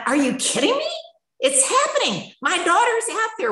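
very high, incredulous protest; indignant outburst. Retold or imitated. Mother answering her meeting about her missing daughter, indignant. Snaps back at meeting's suggestion.

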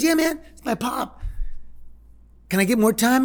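high, eager imitation of the son; son asking. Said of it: "He goes".

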